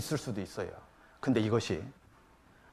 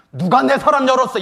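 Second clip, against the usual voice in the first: loud, raised mock outburst; accusing teenager; 9 semitones higher, 9 dB louder than usual. High-schooler finds drawer touched, angry imitated accusation. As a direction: demand an answer like an outraged teen.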